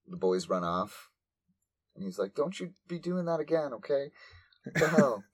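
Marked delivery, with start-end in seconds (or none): 2.3-5.2 nonchalant scolding. Retold or imitated. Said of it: "It's very nonchalant".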